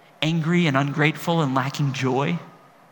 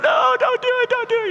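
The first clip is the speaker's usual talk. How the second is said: high, loud panicked plea; scared kid pleading; 4 dB louder than usual. Retold or imitated. Frightened little boy pleading with his brothers, high and panicked. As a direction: beg them not to throw you in. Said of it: "I'd be like".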